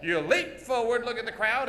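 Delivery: normal talking voice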